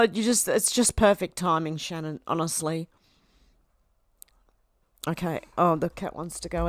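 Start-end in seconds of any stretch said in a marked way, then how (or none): none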